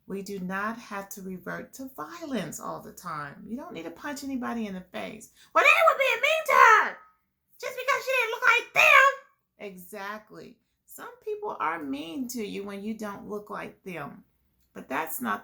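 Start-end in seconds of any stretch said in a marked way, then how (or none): 5.5-9.1 put-on much higher, louder, fast rowdy puppet kid; giggly puppet yelling about the story; Libby puppet voice. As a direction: shout it out like an excited little puppet.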